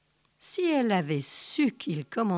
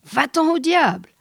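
wife's snapped curse; 7 semitones higher, 6 dB louder than usual. A narrator's reading. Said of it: "qu'elle lui disait".

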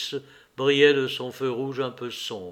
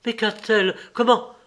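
alarmed questions; 7 semitones higher, 5 dB louder than usual. A narrator's reading. Levin alarmed at Kitty's illness.

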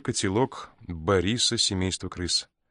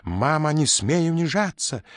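high, loud furious yell; angry shout; 8 semitones higher, 4 dB louder than usual. A narrator's reading. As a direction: snap at his mother. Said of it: "злобно крикнул Нюся".

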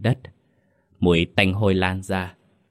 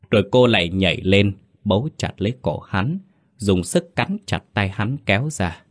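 plain reading voice

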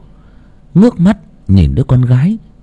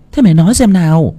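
put-on old mother; 7 semitones higher than usual. A narrator's reading. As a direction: reassure her daughter as the grandmother. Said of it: "Bà mẹ lên tiếng". (bà cụ bảo con ở lại, giọng cao)

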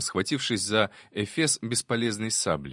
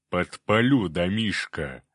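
low, slow cyclops rumble; a lower, slower monster voice with an offer; 3 semitones lower, 3 dB louder, slower than usual. A narrator's reading. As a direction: play a big, slow-witted cyclops.

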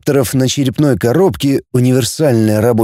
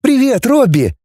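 much higher, happy hello; delighted greeting; 13 semitones higher than usual. A narrator's reading. Girl greets the robot Robby, delighted. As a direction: greet an old friend with delight. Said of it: "обрадовалась девушка".

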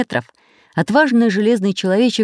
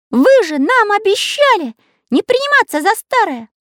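Karandash's voice; 3 dB louder than usual. A narrator's reading. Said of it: "нервно расхаживая по палубе".

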